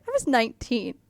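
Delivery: normal talking voice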